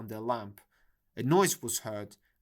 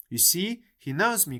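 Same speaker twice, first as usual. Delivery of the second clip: high, raised plea to the crowd; appealing cry; 5 semitones higher, 4 dB louder than usual. A narrator's reading. Nancy appealing to the bystanders, a high raised cry. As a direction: call out to win the onlookers over. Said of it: "cried Nancy, appealing to the bystanders".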